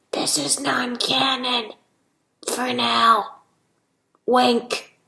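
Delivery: slow, conspiratorial stage whisper; playful whispered aside. Pretend. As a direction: whisper a sly aside to the listeners.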